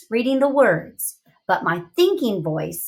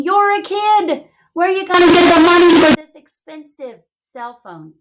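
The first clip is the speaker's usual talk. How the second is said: loud, higher incredulous inner voice; thinking voice; 8 semitones higher, 8 dB louder than usual. Acted. Teacher voicing her thinking voice at the boy, loud and higher. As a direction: blurt the doubt out loud. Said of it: "My thinking voice is saying".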